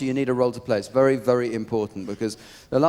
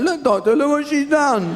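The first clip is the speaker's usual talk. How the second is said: higher, louder whiny complaint to the doctor; husband complaining to the doctor about his hand; whining husband; 12 semitones higher, 4 dB louder than usual. Retold or imitated. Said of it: "I was going".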